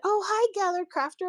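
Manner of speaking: high-pitched, chirpy mock hello; cheery retold greeting. Show host greets a visitor who swiped in, cheerily. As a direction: imitate a friendly host's bright greeting.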